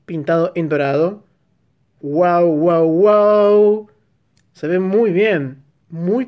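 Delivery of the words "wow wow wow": long drawn-out admiring wow; asombro alargado. Real, not acted. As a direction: stretch each wow out in admiration. Admiring the painted part. (wow de asombro, bien alargado)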